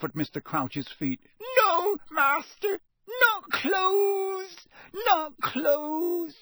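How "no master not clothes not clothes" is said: high, loud, wailing elf plea; sobbing elf shout. A narrator's reading. Elf begs her master at his feet, wailing loudly. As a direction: cry out begging.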